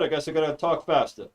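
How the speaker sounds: ordinary talk, nothing marked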